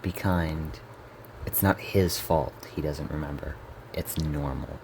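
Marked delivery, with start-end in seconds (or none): 3.9-4.8 quiet self-reassurance. Acted. Narrator calming themself about the brother, quiet self-reassurance. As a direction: tell yourself softly, under control. Reminds self to stay cordial.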